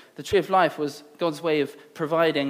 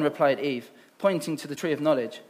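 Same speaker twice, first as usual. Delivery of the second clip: ordinary talk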